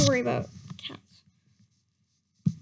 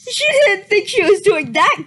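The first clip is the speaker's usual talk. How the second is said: louder, laughing incredulous reread; mocking disbelief; 4 semitones higher, 8 dB louder than usual. Real, not acted. Reader mocking the fanfic line to her viewers, incredulous and loud. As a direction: repeat it as if it's absurd.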